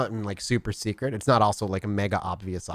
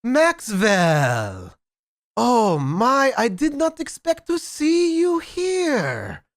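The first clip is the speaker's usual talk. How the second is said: loud, high, hammed-up German accent; booming German accent; 8 dB louder, much slower than usual. Sire hails Max from behind, booming German accent. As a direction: boom it out slowly in a bad German accent. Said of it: "in a loud, booming voice, you hear in a horrible German accent".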